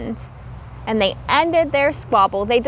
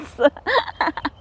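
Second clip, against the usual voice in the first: giggle; 2 semitones higher, 3 dB quieter than usual. Acted. Bruce explodes in giggles.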